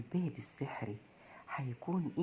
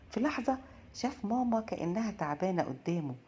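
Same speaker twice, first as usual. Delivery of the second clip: usual speaking voice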